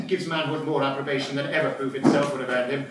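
ordinary talk, nothing marked

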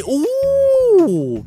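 high, drawn-out "uuh"; long high whoop of delight; excited whoop. Real, not acted. Player finds the costume he wanted, excited whoop. Finds the gelatin suit in game.

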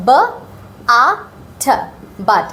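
বাট split into ব, আ, ঠ then blended; sounding out. Shown as a demonstration. Teacher sounding out but for students. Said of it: "এইখানে আছে".

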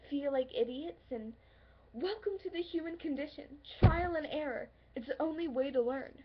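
loud, insistent pleading that builds; exasperated outburst. Acted. Arguing with a lover who says they're not ready, exasperated. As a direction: argue it hard, rising to near shouting. Acted argument with reluctant lover.

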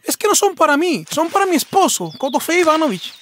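high, chirpy boasting fox voice; proud fox. Acted. Fox to the bear, boasting brightly. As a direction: show off her husband's rank.

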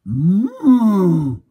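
loud high furious moo; angry moo. Acted. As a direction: moo like an enraged cow. Furious cow moos.

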